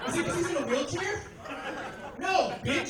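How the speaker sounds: high, indignant comeback; mock outrage. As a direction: blurt it out, comically offended. Acted comeback to fiancee's question.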